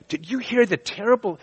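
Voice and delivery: outraged gossip. Retold or imitated. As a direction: sell the shock of the news. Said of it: "And they tell you".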